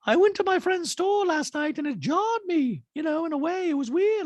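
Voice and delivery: Dorit impression. Dorit praising PK in dual talking head, high put-on voice. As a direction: coo it in a squeaky voice.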